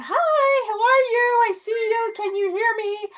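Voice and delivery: greeting the orb in a silly high voice. Retold or imitated. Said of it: "I'm being silly and I'm going".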